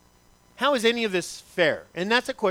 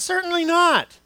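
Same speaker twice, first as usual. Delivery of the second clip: much higher and louder; emphatic exclamation; 10 semitones higher, 4 dB louder than usual. Acted. Pastor proclaims Paul's answer, higher and louder. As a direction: ring it out like a triumphant no.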